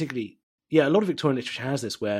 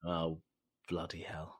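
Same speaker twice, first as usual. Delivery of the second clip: very soft, low near-whisper; hushed dismay; 7 semitones lower, 15 dB quieter than usual. Real, not acted. Guest realises mum knew what she was doing, hushed dismay. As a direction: breathe it out under your breath. Muttered reaction to radio realisation.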